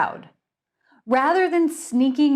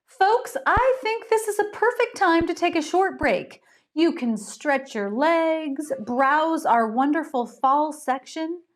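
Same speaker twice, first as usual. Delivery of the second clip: high, bright hostess announcement; smooth over tension, much higher; cheerful store host; 6 semitones higher than usual. Acted. Gretchen voiced at length.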